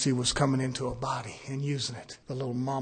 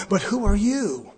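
taunting devil; 6 semitones higher, 6 dB louder, slower than usual. Retold or imitated. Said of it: "And he says".

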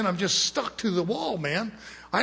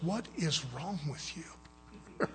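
in-your-face confrontation; 10 dB quieter, much slower than usual. Retold or imitated. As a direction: quote him confronting you up close, slowly and softly. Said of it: "gets right in my face".

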